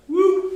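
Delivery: quick imitated whoop; playful sound of a sidestep; little "whoop" sound effect; dodging whoop.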